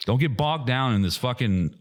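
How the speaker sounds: ordinary talk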